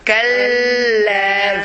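"كلا" slow, with a long doubled l; shadda on lam. Shown as a demonstration. Teacher explaining the shadda, "كلا" with l held long. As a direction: hold the lam in "كلا", then stretch the final vowel. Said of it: "the lam has a shadda".

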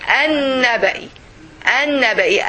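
"النبأ" as "an-naba", with the n held long; silent lam. Shown as a demonstration. Teacher showing how she pronounces it, "النبأ" with a long n. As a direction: skip the lam in "النبأ" and lean on the doubled n. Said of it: "you see how I pronounce it".

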